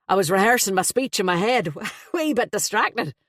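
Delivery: a flustered, jovial Dubliner blurting out; Irish best man. Acted.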